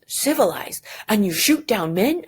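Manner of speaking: dramatic quote. Acted. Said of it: "There's a very dramatic moment".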